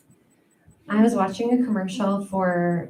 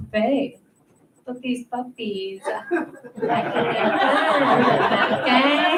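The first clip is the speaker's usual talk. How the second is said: higher, giggling through the boast; flirty laughing boast; 3 semitones higher than usual. Retold or imitated. Flirting with babe while laughing at herself. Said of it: "I'm like".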